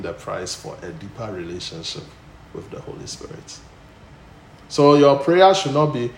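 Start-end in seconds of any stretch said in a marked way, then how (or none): 4.7-6.1 loud, soaring preacher's voice; full-force preaching. Acted. Pastor thunders to the church about Paul, loud preaching. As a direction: preach it out to the congregation.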